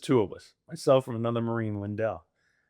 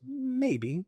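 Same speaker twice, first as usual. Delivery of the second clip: a high, soft, teasing maybe; wife teasing her husband about his early homecoming; coy teasing; 12 semitones higher, 6 dB quieter than usual. Retold or imitated. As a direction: tease him with it lightly. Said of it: "She goes".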